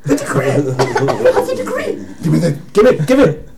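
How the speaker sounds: loud, high-pitched panicked grabbing; hammed-up desperate demand to get it back; frantic pleading. Paladin grabs for his snatched decree.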